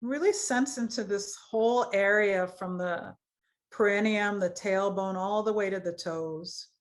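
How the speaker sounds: measured phrases with long pauses; guided meditation. Acted. Yoga teacher leads students through root chakra, guided meditation voice. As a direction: guide awareness through the body, leaving space to feel.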